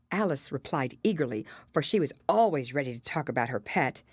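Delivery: usual speaking voice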